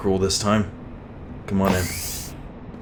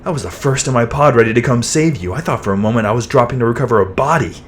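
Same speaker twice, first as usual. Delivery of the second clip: upset, raised-voice concern; worried scolding; 7 dB louder than usual. Acted. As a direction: let the fright spill out as a loud telling-off. Friend scolds after a near death.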